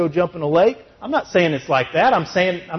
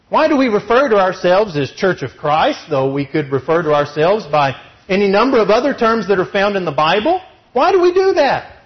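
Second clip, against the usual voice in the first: loud, high, driving sermon voice; fiery preaching; 8 semitones higher, 5 dB louder than usual. Acted. Preacher drives his point home to the church, fired up. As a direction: preach it hard to the congregation.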